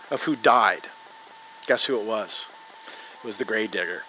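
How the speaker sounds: low, soft storytelling hush; hushed reveal. Real, not acted. Preacher revealing who died in the coffin, hushed reveal. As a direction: drop low and quiet for the creepy twist.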